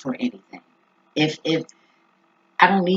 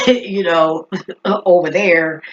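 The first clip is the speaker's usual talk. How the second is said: giggling through the words; laughing; 2 semitones higher, 7 dB louder, slower than usual. Real, not acted. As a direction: laugh while saying it. Laughing about the chanting.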